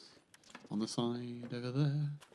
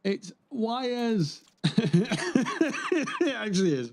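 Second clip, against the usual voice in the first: laughing sarcastically; 10 semitones higher, 4 dB louder, much slower than usual. Real, not acted. Sarcastic reaction to loot.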